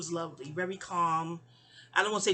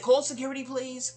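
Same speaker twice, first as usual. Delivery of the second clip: raised, higher nurse's call; imitated calling out; 4 semitones higher, 4 dB louder than usual. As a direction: call over to the station, lightly acted. Nurse calls over to nursing station.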